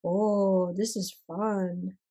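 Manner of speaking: ordinary delivery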